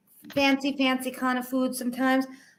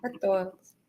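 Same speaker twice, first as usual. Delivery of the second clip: Chicago accent; 6 semitones lower, 6 dB quieter than usual. Shown as a demonstration. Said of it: "I do hear it when you say hot dogs".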